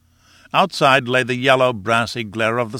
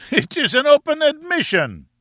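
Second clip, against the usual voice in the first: very high, crowing exclamation; derisive cry; 4 dB louder than usual. Acted. Professor mocks the blank sheet, high derisive cry. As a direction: cry out in scornful triumph, far above the usual pitch. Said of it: "discordant burst of derisive laughter".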